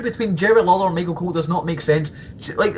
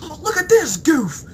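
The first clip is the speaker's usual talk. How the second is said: mock sneering commentator; 9 semitones higher than usual. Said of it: "what do you hear?".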